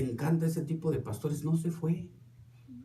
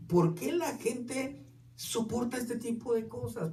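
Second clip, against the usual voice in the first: exasperated wondering; 5 semitones higher, 4 dB quieter, slower than usual. Retold or imitated. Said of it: "Y yo decía".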